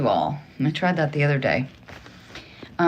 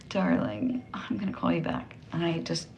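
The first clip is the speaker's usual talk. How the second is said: whispering; 9 dB quieter than usual. Real, not acted. Aside whisper to caller while live.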